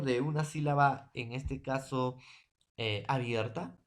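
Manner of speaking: ordinary talk, nothing marked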